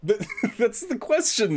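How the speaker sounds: laughing through the words. Real, not acted. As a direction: chuckle while you say it. Laughing at the clip.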